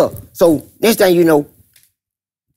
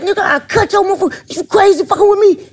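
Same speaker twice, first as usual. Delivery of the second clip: mock yell; 14 semitones higher than usual. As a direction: holler the threat high. Dirty yelling at Teddy.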